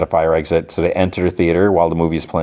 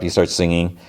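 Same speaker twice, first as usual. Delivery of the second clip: normal talking voice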